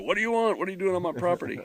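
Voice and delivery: a hammed-up territorial old guy; grumpy old man. Imagined bearded man with shotgun yelling at trespasser, mock gruff. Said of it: "some old dude with a big beard and a shotgun and a bad attitude".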